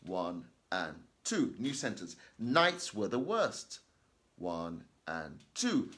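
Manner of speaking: teacher modelling the gap between sentences; reading with pauses between sentences. Shown as a demonstration. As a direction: pause two beats at every full stop. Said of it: "Again, two seconds in between each one".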